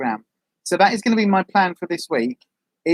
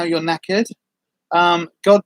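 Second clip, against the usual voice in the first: ordinary talk, nothing marked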